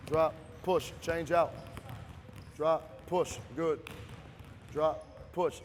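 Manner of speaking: loud drill calls; barking gym coach. Real, not acted. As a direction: bark each command short. Coach calling drill to players.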